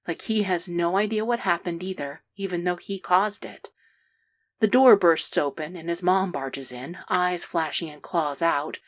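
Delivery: ordinary delivery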